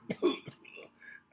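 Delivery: a dry cough from exhaust. A narrator's reading. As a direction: cough on the fumes. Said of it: "Aspirin coughed".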